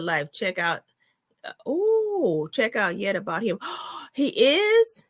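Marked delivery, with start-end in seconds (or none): 1.7-2.5 high, drawn-out ooh of shock; startled "ooh". Real, not acted. Reacts to shocking viewer comment. 3.6-5.0 gasp, then a high, rising question; shocked surprise. Real, not acted. Gasps at news from chat.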